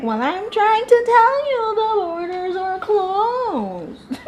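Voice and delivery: high slow whiny mimic through laughter; mocking whine, laughing. Mocking the wife on the closed borders, high and laughing. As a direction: giggle while mocking her high voice. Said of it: "she was like".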